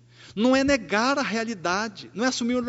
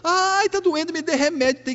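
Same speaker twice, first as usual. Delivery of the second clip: mock cry of pain; 9 semitones higher, 4 dB louder than usual. Patient begging in pain.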